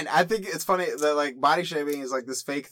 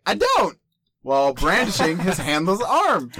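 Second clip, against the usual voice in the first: loud, high punch on the key words; happy-go-lucky, loudly defiant delivery; exuberant proud kid; 5 semitones higher, 5 dB louder than usual. A narrator's reading. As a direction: read it boldly, brandishing every phrase. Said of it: "the way you said it made it sound that way".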